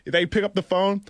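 normal talking voice

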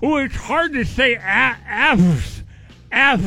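talking around a finger; words muffled by a finger; finger in mouth. Real, not acted. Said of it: "hard to say F words with your finger in your mouth".

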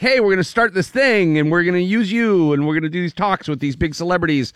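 high, eager promoter voice; mock pitch. Celebrity organizers pitching to the kid, high and eager. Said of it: "everyone was like".

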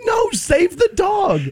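high, drawn-out mock plea for the dog; pleading outcry. Movie fan begging at the film's end, high mock plea. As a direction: cry it out high and slow like a desperate viewer. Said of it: "at the end you're like".

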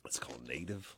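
soft unvoiced whisper. Real, not acted. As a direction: whisper it under your breath. Whispered aside.

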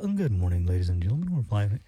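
very low, droning captain's greeting; standard pilot voice. Acted. Host showing how every pilot sounds, laid-back pilot voice. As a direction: sink deep into a laid-back intercom drone. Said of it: "every single pilot sounds exactly the same".

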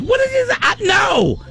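high, shouted protest; hammed-up exasperated yell; indignant shout. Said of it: "I'm like".

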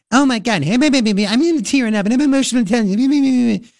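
high, shrill mocking caricature; mock Kirsten Gillibrand. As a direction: mimic a tearful politician in a squeaky, babbling whine. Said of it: "how shrill is she?".

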